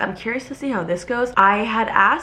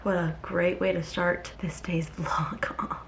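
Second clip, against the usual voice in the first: whispering; 9 dB quieter, slower than usual. Real, not acted. After hitting her funny bone.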